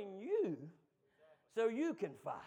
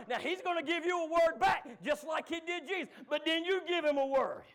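higher, louder shouted preaching; full-force preaching; 10 semitones higher, 10 dB louder than usual. Acted. Preacher urging church to give him the Word, shouted.

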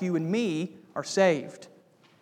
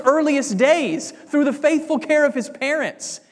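louder, rising sermon voice, pressing each point home; emphatic preaching; 4 semitones higher, 4 dB louder than usual. Acted. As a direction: preach it to the congregation with building force. Preacher's climax on obedience.

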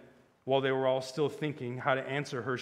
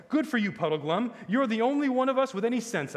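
higher, louder hearty cheer; excited praise; 10 semitones higher, 7 dB louder than usual. A narrator's reading. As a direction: cheer your friend on eagerly. Scrub cheers Puddleglum on.

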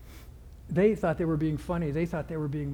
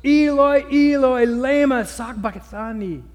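anguished high cry, much louder; 5 semitones higher, 10 dB louder than usual. Retold or imitated. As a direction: cry out to heaven in agony. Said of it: "cried out in a loud voice".

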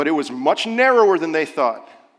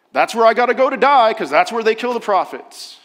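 higher, louder, fast mock sarcasm; sarcastic; 7 semitones higher, 7 dB louder than usual. Pastor voicing Jesus's sarcasm about Jerusalem. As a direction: toss it off with biting irony. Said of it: "notice the hint of sarcasm here".

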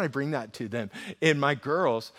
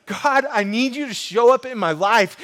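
pleading cry; 9 semitones higher, 12 dB louder than usual. Retold or imitated. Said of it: "begging and crying out to God".

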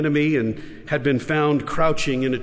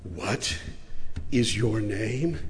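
much softer, slow, searching; hushed repeat; 5 semitones lower, 6 dB quieter, much slower than usual. Retold or imitated. The Lord softly repeats his question, hushed and slow. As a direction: ask it again, barely above a breath.

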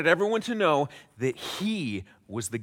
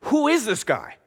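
higher, louder, affronted disbelief, slowing at the end; indignant scribes; 8 semitones higher, 5 dB louder than usual. Retold or imitated. Scribes scoffing at Jesus inwardly, higher indignant mock outrage. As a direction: sputter like an offended elite. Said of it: "They just thought it".

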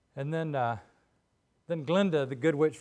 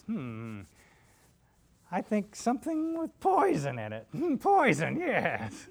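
high cackling witch voice; scheming witch, high and nasal; Wicked Witch voice; 7 semitones higher, 4 dB louder, slower than usual. Acted. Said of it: "the Wicked Witch of the West ... she says".

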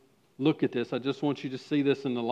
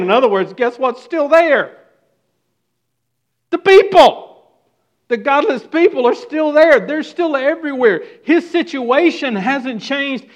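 loud, high impassioned preaching; preaching at full force; 7 semitones higher, 10 dB louder than usual. Acted. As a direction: drive the point home to the congregation.

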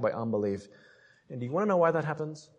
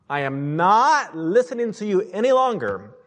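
firm refusal; 7 semitones higher, 6 dB louder than usual. Acted. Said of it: "tell that old man to shut up".

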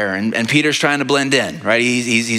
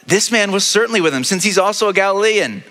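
accuser; 7 semitones higher than usual. Acted. Bystander accusing Peter by fire.

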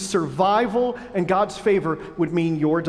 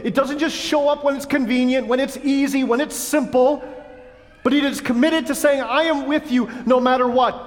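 impassioned preaching; 6 semitones higher than usual. Real, not acted. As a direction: drive it home with fervour. Preacher builds to passionate climax.

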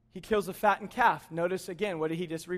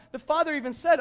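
normal talking voice

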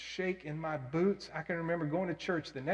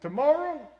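eager answer; 9 semitones higher, 5 dB louder than usual. Retold or imitated. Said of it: "I said".

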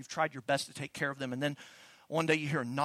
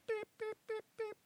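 alarm beeping; 7 dB quieter than usual. Retold or imitated. Mimics missile alarm.

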